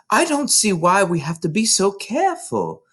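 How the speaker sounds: put-on high, restless child voice; impatient excited girl. A narrator's reading. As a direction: protest while hopping about. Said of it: "still hopping about".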